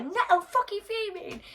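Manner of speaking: high, slow furious cry; refusing to calm down, furious; fuming. Retold or imitated. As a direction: spit it out, livid. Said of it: "I'm like".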